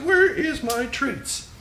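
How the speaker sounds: high, slow silly husky voice; dog voice. Acted. Owner voicing his husky asking for treats, high dog voice. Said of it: "and he's like".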